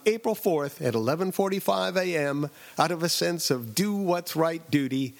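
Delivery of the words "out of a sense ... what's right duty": usual speaking voice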